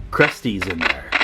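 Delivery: ordinary talk, nothing marked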